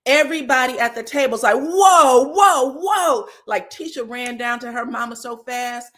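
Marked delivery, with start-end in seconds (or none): 1.6-3.3 loud, high group whoa. Retold or imitated. Everybody at the table reacting, loud alarmed whoa.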